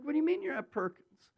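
high, incredulous question; mock-astonished phone reply; retold disbelief. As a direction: act baffled, voice jumping up. Retold disbelieving phone call to patient.

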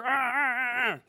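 high exasperated ah ah ah; frustrated groaning. Retold or imitated.

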